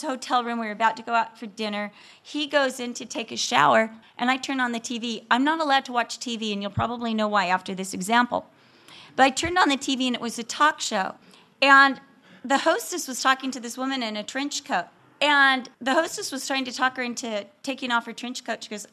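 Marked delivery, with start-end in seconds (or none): none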